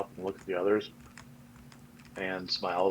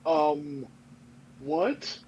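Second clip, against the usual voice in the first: startled; 6 semitones higher than usual. Acted. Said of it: "jolts back".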